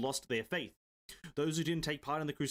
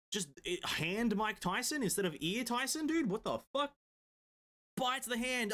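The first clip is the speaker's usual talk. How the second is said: hyped disbelief; 8 semitones higher than usual. Real, not acted. Excited reaction to the scene.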